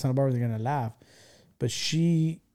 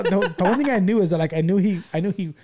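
ordinary talk, nothing marked

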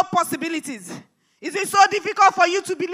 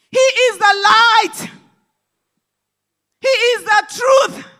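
slow, loud, higher preaching shout; shouted proclamation; 4 semitones higher, 6 dB louder, slower than usual. Acted. Preacher proclaims Jesus to the congregation, slow shout. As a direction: proclaim each word to the back row.

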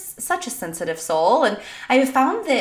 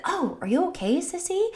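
put-on higher, softer small-child concern; little boy comforting sister; 8 semitones higher, 5 dB quieter than usual. Son to his sister who fell, sweetly concerned. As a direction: imitate a kid checking on his sister. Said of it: "And he said".

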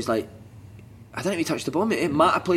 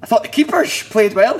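high, louder mock of the chairman's line, laughing through it; mimicking the chairman; 7 semitones higher, 7 dB louder than usual. Chairman praising keepers, mocked by a laughing player. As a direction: ham up the guest's earnest praise while laughing. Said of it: "I remember a guy going".

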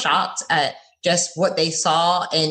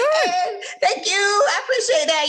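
high, laughing through the thanks; giggly, flattered thank-you; slower than usual. Real, not acted. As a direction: bubble over with laughter as you thank them.

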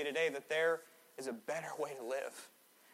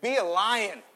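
"Be a lion" said higher and louder, bold; loud rousing call; 5 semitones higher, 6 dB louder, slower than usual. Shown as a demonstration. Preacher urging the church to say it boldly, loud call. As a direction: shout "Be a lion" to rally them. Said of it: "Let's try again".

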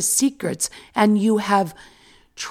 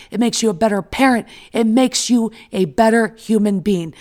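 ordinary talk

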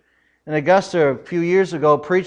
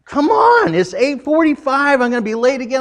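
impatient yell; 10 semitones higher, 5 dB louder than usual. Retold or imitated. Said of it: "I'm like".

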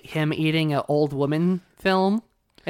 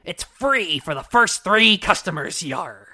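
slow, louder pirate pitchman; 4 dB louder, slower than usual. Retold or imitated. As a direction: sell it like a pirate ad. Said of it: "the commercial goes on to say".

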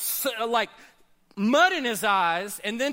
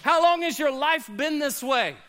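ordinary talk

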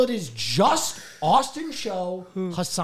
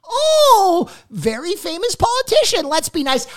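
very high, mock-sweet gushing; fawning crowd; 13 semitones higher, 4 dB louder than usual. Streamers greeting a politician in their game, mock fawning. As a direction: imitate everyone fussing over a celebrity. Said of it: "and everyone was like".

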